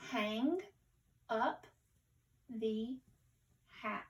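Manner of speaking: one word at a time, spaced out for beginning readers; careful, word by word. Shown as a demonstration. Teacher modelling careful reading for pupils, word by word. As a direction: read slowly with a clear pause after each word. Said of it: "read it again more fluently".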